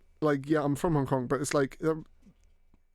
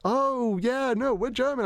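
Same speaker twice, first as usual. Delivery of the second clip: much higher, drawn-out imitation of mum; mock sudden realisation; 10 semitones higher, slower than usual. Mum correcting herself to the ticket person, mock realisation. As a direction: play an exaggerated change of story.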